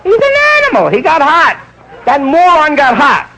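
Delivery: high falsetto, slowing on moron; disgusted nurse. Acted. Nurse calls the patient a moron in falsetto. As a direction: shriek it in mock disgust.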